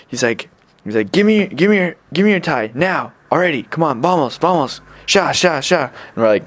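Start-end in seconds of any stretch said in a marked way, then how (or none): 1.1-5.9 put-on high, impatient drunken urging; imitated drunk demand. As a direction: mimic a drunk hurrying someone along. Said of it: "drunk and drugged up".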